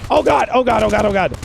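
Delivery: panicked high babbling; terrified gibbering. Real, not acted. Panic in dark mission.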